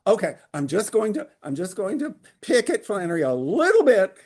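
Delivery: high, loud, amused protest; playful self-quote. Retold or imitated. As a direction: act out your own eager reply, high and loud. Jokingly quotes own reaction.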